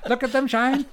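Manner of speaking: giggling high mock-character line; laughing high quote. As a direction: squeal it out while laughing. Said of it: "And his shiny mirror balls".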